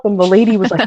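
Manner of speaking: ordinary talk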